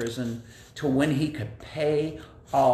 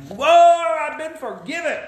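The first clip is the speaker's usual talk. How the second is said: much higher, louder joyful whoa; delighted exclaim; 13 semitones higher, 7 dB louder than usual. Acted. Forgiven man dances, high delighted whoa.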